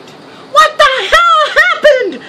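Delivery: high, loud, laughing disbelief; a mother's alarmed shout through laughter; shocked yell. Retold or imitated. Mother hears son's alarming call.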